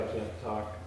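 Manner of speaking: ordinary delivery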